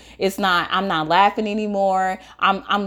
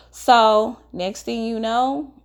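ordinary delivery

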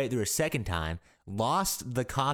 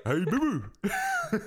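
higher cartoon bear call; Yogi Bear. Podcaster answers with the cartoon bear's catchphrase, higher voice. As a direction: greet a sidekick in a pitched-up cartoon voice. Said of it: "almost sounded like Yogi Bear".

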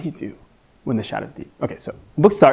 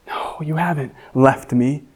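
quiet reassurance; 5 dB quieter than usual. Retold or imitated. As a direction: gently remind yourself. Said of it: "I remind myself".

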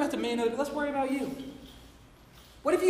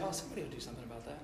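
dismissive shrug; 10 semitones lower, 15 dB quieter, much faster than usual. Retold or imitated. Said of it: "But then we say".

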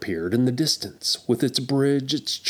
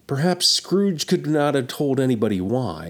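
ordinary delivery, nothing marked

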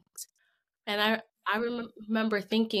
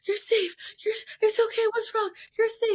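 put-on high little-boy voice, much higher; imitating her toddler repeating; 13 semitones higher, 3 dB louder than usual. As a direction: echo her words back in a high toddler voice. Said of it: "he's repeating".

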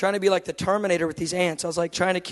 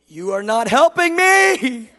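slow yell climbing higher and louder; exasperated shout; 6 semitones higher, 11 dB louder, slower than usual. Retold or imitated. Frustrated man to God, rising exasperated shout. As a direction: stretch each word into a rising, hammed-up holler.

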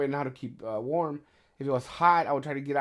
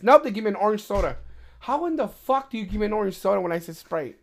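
higher and louder fed-up complaint; loud disbelieving gripe about the order; exasperated rant; 7 semitones higher, 5 dB louder than usual. Real, not acted. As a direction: vent like you can't believe it. Ranting about wrong soda.

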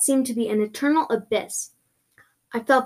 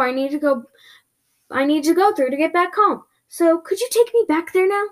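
higher, louder, pressing plea; insistent demand; 7 semitones higher, 5 dB louder than usual. Acted. To the creature who ran from the door, insistent demand. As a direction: press to be taken back.

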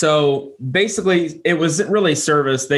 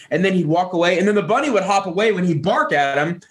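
ordinary delivery

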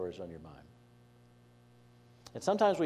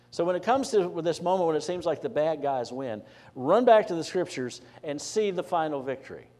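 higher, louder pulpit exhortation; preaching at full force; 6 semitones higher, 6 dB louder than usual. Acted. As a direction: urge the congregation, voice lifted.

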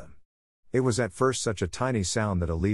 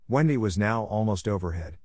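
ordinary delivery

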